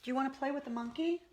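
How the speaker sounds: high, sing-song coaxing of a pet. Real, not acted. Owner calling her pug to come get the monkey toy, high coaxing baby talk. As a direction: coax the sleepy pug over with a toy.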